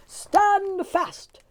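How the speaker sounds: loud, high call down the hollow. A narrator's reading. Tim calling down into the hollow to the wee folk. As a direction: call down to stop them. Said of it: "Tim called down".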